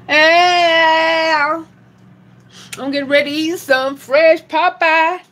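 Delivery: high, loud excited call. Real, not acted. Excited shout to viewers about her chicken. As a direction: sing it out to the whole street.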